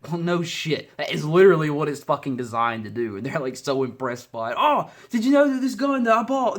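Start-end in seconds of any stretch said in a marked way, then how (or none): 4.6-6.6 high, loud mock British accent. Mocking the impressed gun owners, mock British accent. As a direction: put on a silly high British voice. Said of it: "I went to a British accent".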